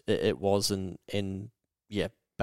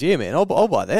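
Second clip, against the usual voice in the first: keen buyer; 6 semitones higher, 6 dB louder than usual. Retold or imitated. Buyer voiced in retold story.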